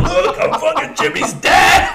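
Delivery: high, slow hollered drunk-dad impression; drunken father. Friend dressed as drunken father at graduation, hollering drunkenly. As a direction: holler it like a sloshed proud parent. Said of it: "dressed as my drunken father".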